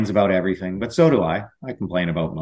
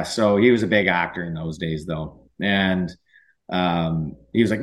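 ordinary talk, nothing marked

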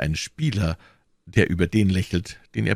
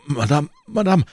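startled, high-pitched stammer; 10 semitones higher, 4 dB louder than usual. A narrator's reading. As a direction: stammer it as if suddenly woken. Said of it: "stammelte er". (erschrocken gestammelt, mit hoher Stimme)

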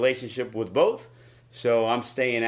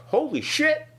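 high, amazed rush of disbelief; retold backstage shock; stunned excitement; 7 semitones higher, 3 dB louder than usual. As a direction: blurt it out like you can't believe your eyes. Shock at Moxley's arrival.

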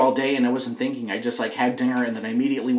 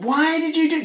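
very high mock-wife call; wife yelling back; 14 semitones higher than usual. Wife questioning husband about the keys, imitated yell. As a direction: imitate a holler from inside the house, pitched way up. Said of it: "she yelled back, like".

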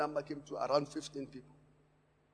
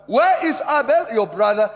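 preached scripture; 5 semitones higher, 13 dB louder than usual. Shown as a demonstration. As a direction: proclaim it to the church. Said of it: "scripture preached loud".

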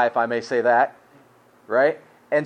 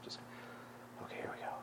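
breathy hushed whisper, bracing; hushed whisper before hard news; 29 dB quieter, much faster than usual. Real, not acted. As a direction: whisper it as if steeling yourself. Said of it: "take a deep breath".

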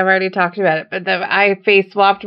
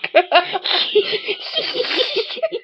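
high, wheezy snickering laugh; wheezy Muttley laugh; 5 dB quieter than usual. Real, not acted. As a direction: snicker like the cartoon dog. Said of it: "my Muttley laugh kicks in".

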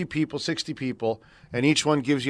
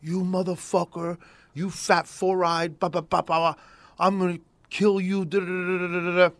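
retold angry threats; 6 semitones higher than usual. Jealous ex-boyfriend threatening on the phone, mock angry rant. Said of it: "her ex-boyfriend calls".

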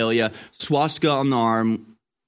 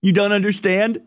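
sarcastic mock disbelief; 7 semitones higher than usual. Mocking sarcastic retort.

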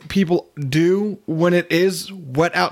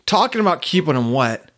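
ordinary delivery

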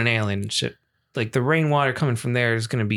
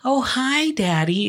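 high-pitched mock sweet child; sweet little kid; 11 semitones higher, slower than usual. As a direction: play the innocent little boy greeting dad. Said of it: "he was like".

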